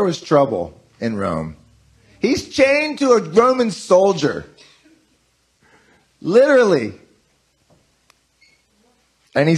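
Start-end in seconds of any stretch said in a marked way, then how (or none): 2.2-6.9 emphatic. Acted. Emphatic point about Paul.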